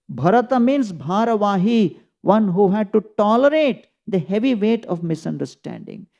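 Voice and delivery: ordinary delivery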